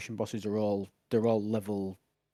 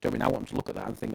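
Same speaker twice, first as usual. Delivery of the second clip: ordinary talk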